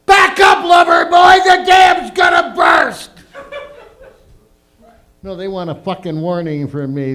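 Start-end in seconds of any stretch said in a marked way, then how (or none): 0.1-3.1 shrieked very high and loud; girl yelling out. Retold or imitated. Girl shrieking a warning to her lover, very high. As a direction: screech the warning like a siren. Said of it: "have some girl yell out".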